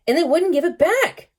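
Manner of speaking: a high, mildly tearful wail; crying openly. Acted. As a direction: let the crying break into the words. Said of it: "crying openly".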